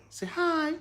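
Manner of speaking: squeaky high coaxing to his son. Real, not acted. Coaxing baby.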